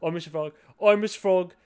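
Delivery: loud, silly cartoon frog; Mister Frog. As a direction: announce yourself as a goofy frog host. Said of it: "He has a Mister Frog accent".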